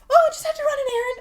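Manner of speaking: high, loud and fast, overly innocent; innocent mom. Retold or imitated. Mom covering her secret errand, high innocent sing-song. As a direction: play the mom acting innocent. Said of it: "and she's like".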